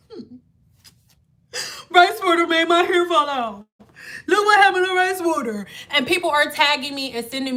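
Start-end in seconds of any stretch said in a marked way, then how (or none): 0.1-5.7 whiny complainer. Retold or imitated. Vlogger mimicking posts about hair falling out, whining. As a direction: whine like a regretful poster.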